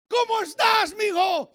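high, loud acted hello; shouted fatherly greeting. Preacher voicing God greeting a son, shouted. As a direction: call out to your child from afar.

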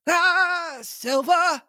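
put-on high, slow old castaway voice; Ben Gunn voice. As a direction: lift into a cracked old sailor. Ben Gunn voiced in read story.

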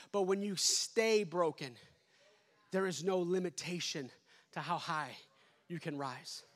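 fading to hush. Real, not acted. Preacher softening to an intimate close.

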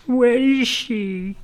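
high, very slow northern wail; mock northern accent. Playing someone who lost their mum, mock northern wail.

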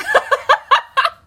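high, loud cackling laugh; real burst of laughter. Real, not acted. Laughs at husband's calm reply.

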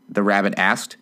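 ordinary delivery

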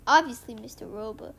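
usual speaking voice

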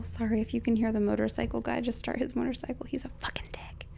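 unvoiced hushed whisper. Real, not acted. Hosts whispering about the noisy motorcycle neighbor, hushed. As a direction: whisper so the neighbor won't hear.

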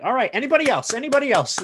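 high, loud crowd calling out; mock crowd. Crowd wanting the next comic, high and loud. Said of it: "the crowd was just like".

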